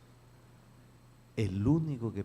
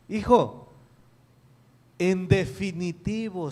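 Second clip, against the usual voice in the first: firm father; 7 semitones higher, 7 dB louder than usual. Retold or imitated. Father firmly answers son.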